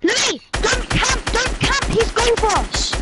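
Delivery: high frantic yelling at teammate; frantic shout. Real, not acted. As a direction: yell his name in a panic. Yelling at teammate mid-fight.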